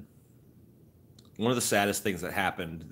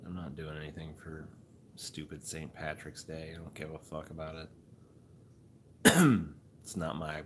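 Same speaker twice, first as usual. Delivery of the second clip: ordinary talk, nothing marked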